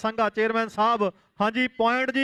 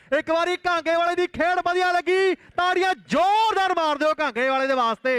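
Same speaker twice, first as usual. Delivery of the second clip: excited commentary; 6 semitones higher than usual. Real, not acted. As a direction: raise it for the big tackle. Crowd cheering, big tackle call.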